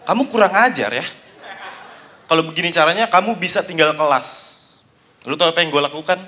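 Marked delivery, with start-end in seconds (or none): 0.0-4.6 mock scolding principal. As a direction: scold the cheeky student. (kepala sekolah memarahi murid dengan nada tinggi)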